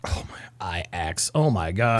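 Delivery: very soft, low aside; hushed, slow reaction; muttered disbelief. Real, not acted. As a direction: mutter in dismay under your breath. Reacting aside to played clip.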